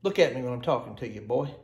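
low, quiet, quick reprimand; muttered mock scolding. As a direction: scold under your breath, half in jest. Mock scolding aside while demonstrating.

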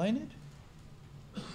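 ordinary talk, nothing marked

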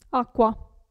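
acqua said akwa. Shown as a demonstration. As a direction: say acqua with a clear kw. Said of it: "si legge sempre Q". (acqua si legge akwa)

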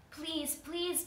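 high-pitched begging; pleading. A narrator's reading. Trapped spider begs the girl, pleading. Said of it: "pleaded the spider".

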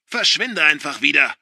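loud furious order to leave; angry. A narrator's reading. Father ordering son out, furious shout. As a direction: bark him out the door.

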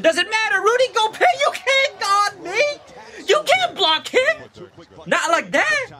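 very high, stretched-out taunt; hyped taunting. Real, not acted. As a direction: squeal it at the beaten defender. Taunting defenders while watching highlights.

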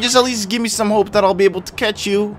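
high, loud begging; panicked shout at the game; desperate pleading. Real, not acted. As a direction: beg it frantically, voice jumping way up. Begs the ball to catch.

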